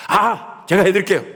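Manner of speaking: put-on high, loud, eager Gyeongsang-accent jump-in; brisk Gyeongsang offer. Gyeongsang elder eagerly offering help, high and loud. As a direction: snap up the task in Gyeongsang style. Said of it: "이게 경상도식인데".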